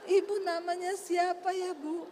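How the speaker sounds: high, sing-song courteous question; sweetly polite. Retold or imitated. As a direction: imitate a suddenly friendly woman. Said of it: "Berubah saudara".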